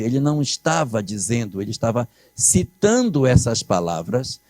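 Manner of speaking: normal talking voice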